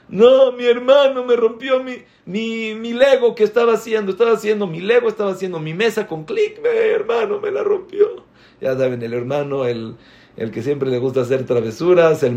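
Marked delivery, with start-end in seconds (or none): 0.1-8.2 put-on high-pitched little-girl wail; hammed-up sobbing tattletale; mock child crying. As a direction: whine a tearful complaint like a six-year-old, ending in a squeal. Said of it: "la hermana de seis años está llorando".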